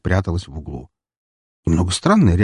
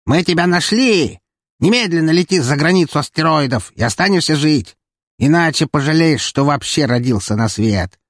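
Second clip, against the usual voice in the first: screeching pirate; 7 semitones higher than usual. Acted. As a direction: screech like a saw on metal. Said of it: "раздался визжащий голос".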